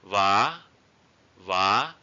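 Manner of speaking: "Va" climbing steeply to a high end; high rising tone. Shown as a demonstration. Teacher models the word for pants, high rising tone. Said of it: "high raising tone".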